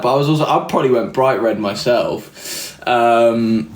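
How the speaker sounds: normal talking voice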